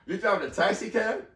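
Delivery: the principal is taken aback; surprised question. Retold or imitated. Principal surprised by the candidate's taxi job. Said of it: "She goes".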